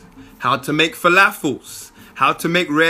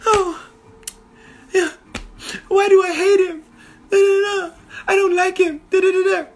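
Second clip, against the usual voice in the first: put-on very high falsetto girly voice; mock Belle, whiny and sing-song; hammed-up high princess fussing over the Beast; 13 semitones higher, faster than usual. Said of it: "she's like".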